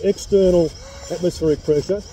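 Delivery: muffled behind respirator; speaking inside sealed mask; through a face mask. Real, not acted. Seen 1: Clear mask covers nose and mouth throughout; R frames show no mask.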